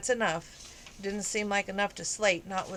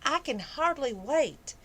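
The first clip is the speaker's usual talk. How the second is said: high, drawn-out country-twang gush; syrupy, exaggerated enthusiasm; 7 semitones higher than usual. A narrator's reading. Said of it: "Faith gushed".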